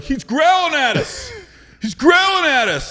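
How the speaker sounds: high, loud, slow frightened wail; whimper-yell in alarm; scared teammate to huddle, panicked. Retold or imitated. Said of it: "the dude was like".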